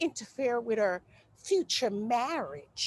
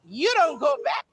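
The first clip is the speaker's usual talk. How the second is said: sassy boast; 3 semitones higher, 5 dB louder than usual. Acted.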